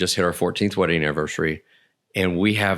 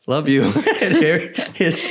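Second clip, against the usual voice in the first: higher and louder, laughing; 8 semitones higher, 4 dB louder than usual. Real, not acted. Husband retelling his sheepish laughing reply. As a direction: laugh out the line.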